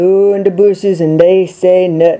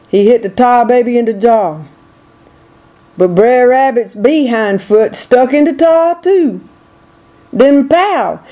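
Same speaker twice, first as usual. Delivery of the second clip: ordinary delivery, nothing marked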